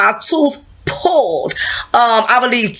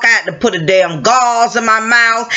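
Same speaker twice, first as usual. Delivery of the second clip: ordinary talk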